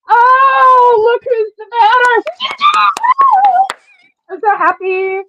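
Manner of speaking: high, loud happy crying shout; tearful joy. Real, not acted. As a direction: cry out with joy.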